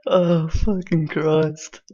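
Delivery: slow, high groan after a laughing fit; worn out from laughing. Real, not acted. Host recovering from the debate clip, spent from laughing. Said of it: "I'm out of breath from laughing".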